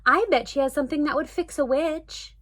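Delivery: put-on a girl hatching a plan; eager little girl. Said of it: "said Dory".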